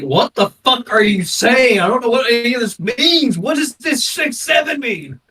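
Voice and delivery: loud, high, exasperated outburst; mock exasperation. Baffled by the new slang, mock exasperation. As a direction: rant in hammed-up bewilderment.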